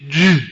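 "Ju-" loud, final consonant left off; toddler's clipped word. Retold or imitated. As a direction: say "Ju-" like a toddler, cut short. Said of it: "he can only say".

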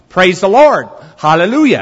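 loud imitation preacher proclamation. Friend proclaiming wherever he went, in a preacher voice. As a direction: proclaim it like a preacher, each phrase falling. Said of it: "he would say in a preacher voice".

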